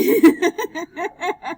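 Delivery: loud, high real laugh; burst of laughter. Real, not acted. Teacher laughing after getting too excited, loud laugh. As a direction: crack up out loud. Teacher amused at own outburst.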